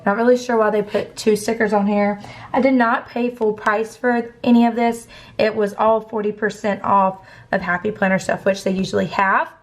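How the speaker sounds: ordinary talk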